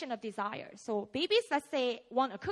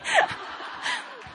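high airy laugh; 3 dB louder than usual. Real, not acted. Laughing at own age joke.

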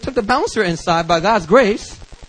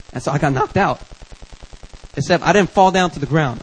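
ordinary talk

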